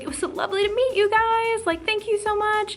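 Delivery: high, gushing imitation of a goodbye; so sweet. Singer saying goodbye to fans she met, sweetly gushing. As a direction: imitate a sweet star's effusive farewell. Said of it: "she was so sweet".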